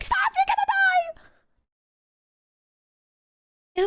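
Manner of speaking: extremely high, stretched-out squeal of a warning; alarmed friend. Retold or imitated. Imitating her friend shrieking a warning, extremely high.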